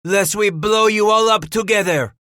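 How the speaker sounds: high, loud threatening bellow. A narrator's reading. Henri Rem threatens the people in the stockade, bellowing. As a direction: bellow the threat across the distance. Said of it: "Henri Rem bellowed".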